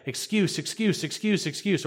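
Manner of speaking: high mocking singsong repetition; mock excuses. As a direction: parody someone piling up excuses. Mocking people's excuses to God.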